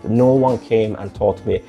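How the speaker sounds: ordinary talk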